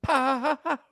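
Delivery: booming ringmaster call. Acted. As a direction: welcome the crowd like a circus ringmaster. Podcast opening announcer.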